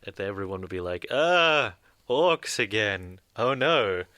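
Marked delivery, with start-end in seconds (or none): 1.1-4.0 loud, exasperated groan at yet another wave. Retold or imitated. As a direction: groan out loud, fed up with the orcs. Players groaning at orc horde.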